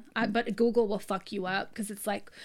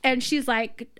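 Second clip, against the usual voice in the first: ordinary talk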